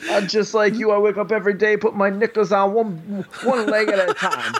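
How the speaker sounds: hammed-up British accent, pitched well up, laughing through it. Host parodying a British cop, in a high, laughing British accent. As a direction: overdo the Brit cop, giggling. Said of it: "purely British".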